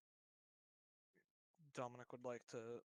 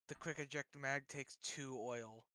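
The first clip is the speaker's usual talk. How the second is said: ordinary talk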